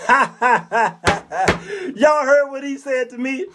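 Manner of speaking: cackling laugh then boasting; mock laughing mother. Mother laughing to her friends about the cursing boy, mock cackle. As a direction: laugh it off to the girls. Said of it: "She turned to her friends and said".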